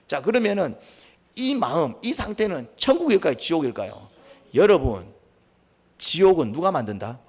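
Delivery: emphatic sermon delivery, pressing each question home; forceful preaching. Acted. Preacher drives the lesson of Cain's heart into the congregation, forceful preaching. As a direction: preach with punched key words and rhetorical questions.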